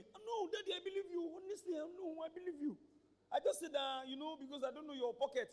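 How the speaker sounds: put-on high pleading child voice; talking doll. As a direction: plead like a little girl. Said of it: "The other one".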